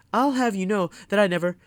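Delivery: butter up the teacher before getting caught; sweet tattletale voice. A narrator's reading. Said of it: "in his sweetest tattletale voice".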